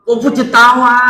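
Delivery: a mock loud answer to a question; loud call. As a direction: call the name out brightly across the room. Calling out her Chinese name.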